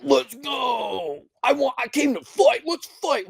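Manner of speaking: high, mocking voice demanding to fight; high mock shout of let's go; mocking fighter. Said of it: "that last little".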